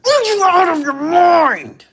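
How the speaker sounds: very high, loud, slow scream of disbelief; outraged shriek. Real, not acted. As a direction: shriek at him as if he's gone mad. Outraged at a viewer's super chat.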